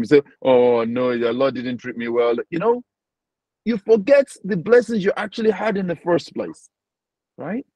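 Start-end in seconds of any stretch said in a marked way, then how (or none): 0.4-2.4 lower, quick mock grumble; mock complaining. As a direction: act out a complaint to God. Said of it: "You complain to him".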